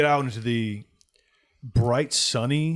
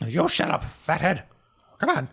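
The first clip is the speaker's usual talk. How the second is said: higher, louder snippy retort; grumpy comeback; 7 semitones higher, 5 dB louder than usual. Acted. Old man bickering with a party member, snippy. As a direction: snap back at him. Herb snapping back.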